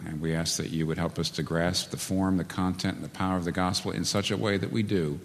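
preacher closing the sermon in quiet prayer. Acted. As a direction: pray it gently for the congregation.

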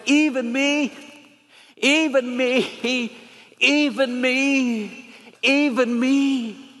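high, very slow, aching repeats; tearful plea. Retold or imitated. Leper woman coming forward in tears, slow and high. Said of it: "they said she was sobbing".

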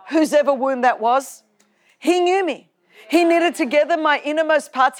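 quoting scripture in a lightly preaching voice; scripture recitation. Acted. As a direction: proclaim it like a sermon.

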